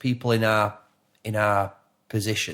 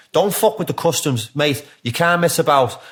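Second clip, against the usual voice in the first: raised-pitch, insistent warning; really intense; 5 semitones higher, 4 dB louder than usual. Retold or imitated. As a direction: imitate someone intensely laying down the law. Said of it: "He's really intense".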